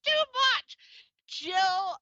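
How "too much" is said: high, loud burst of delight; overwhelmed gushing. Real, not acted. Host overwhelmed by her guest's biography, excited exclamation. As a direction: exclaim it as if overcome.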